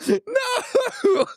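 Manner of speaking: drawn-out wailing "no"; separate, despairing exclamations with a long gap; horrified refusal. Retold or imitated. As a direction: cry out in mock horror. Waking to the dog over him.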